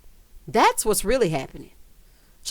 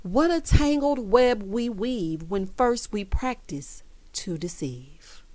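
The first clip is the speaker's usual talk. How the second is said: slow, ending in a soft whisper; dramatic recitation; 4 dB quieter, slower than usual. Acted. Quoting a proverb at Erica, slow and dramatic, into a whisper. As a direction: recite it slowly and fade to a whisper. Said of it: "famous person once said".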